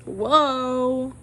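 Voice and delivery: a loud, stretched whoa; drawn-out whoa. Shown as a demonstration. Trying out her new catchphrase, loud and drawn out. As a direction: really sell the catchphrase. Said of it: "I just need to say".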